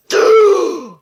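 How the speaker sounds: loud, high gasp of horror; shocked gasp. Acted. Teacher hears the silly answer, loud high gasp. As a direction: gasp as if scandalised.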